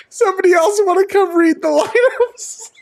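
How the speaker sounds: very high, tearful squeal; crying with laughter. Real, not acted. Host begging someone to read lineups, in hysterics. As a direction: plead through real helpless laughter. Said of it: "I'm crying".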